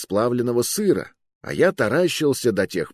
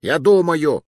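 higher, louder, put-upon exclamation; irritated protest; 7 semitones higher, 5 dB louder than usual. A narrator's reading. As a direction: push back at being hurried. Cornered fat man snaps at mercenary. (раздражённо огрызаясь)

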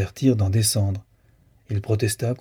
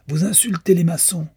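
yelled; 8 semitones higher than usual. A narrator's reading. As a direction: shout back at the speaker, offended. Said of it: "hurla un citoyen". (cri indigné, plus aigu et fort)

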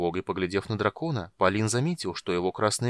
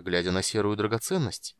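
usual speaking voice

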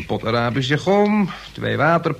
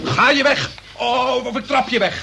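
angry shout; 10 semitones higher than usual. Acted. Son shouts at his uncle to get out, furious. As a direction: shout him out of the house.

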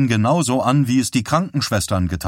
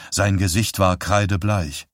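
ordinary delivery

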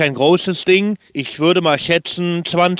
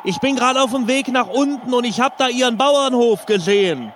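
mock panic; 8 semitones higher than usual. Parachutist prank call continues.

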